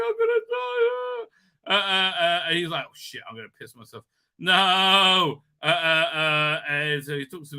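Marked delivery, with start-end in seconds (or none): 0.1-1.3 high, slow, hammed-up screech of terror; panicked shriek. Crew member facing a gun, hammed-up panicked screech. 2.9-4.1 softer, fast mock-terrified gabble; scared mutter. Hostage under the gun, quick mock-scared mutter.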